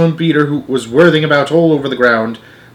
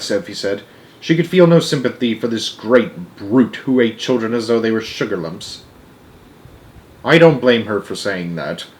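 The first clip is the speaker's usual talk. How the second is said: ordinary delivery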